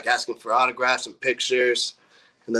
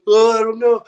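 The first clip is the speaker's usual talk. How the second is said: high, louder mock cluelessness; helpless excuse; 10 semitones higher, 5 dB louder than usual. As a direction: imitate a hapless culprit, pitched way up. Retold drummer's helpless answer.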